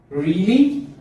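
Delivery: "Really" rising high and loud, as a surprised question; loud, rising "Really" of disbelief; surprised high pitch. Shown as a demonstration. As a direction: shoot "Really" upward in surprise. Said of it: "High pitch".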